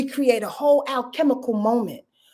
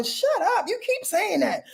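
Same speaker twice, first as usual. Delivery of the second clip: scolding inner voice; 9 semitones higher than usual. Acted.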